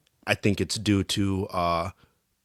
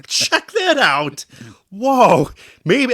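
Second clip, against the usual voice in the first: much higher, louder, slow dazed voice; mocking dopey teammate; 12 semitones higher, 8 dB louder, much slower than usual. Player mocking a teammate watching the puck, dazed high mock voice. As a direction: play a clueless defenseman gawking at the puck. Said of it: "He was like".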